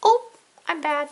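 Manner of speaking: high, put-on puppet voice; Cat in the Hat. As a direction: own up in a high character squeak. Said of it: "you are, Cat in the Hat".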